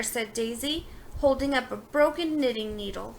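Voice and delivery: ordinary delivery, nothing marked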